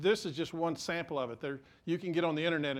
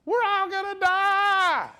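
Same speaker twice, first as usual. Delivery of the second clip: much higher and louder, a hammed-up terrified wail; panicked disciples; 11 dB louder, slower than usual. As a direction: play the disciples screaming that they'll drown. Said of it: "they're like".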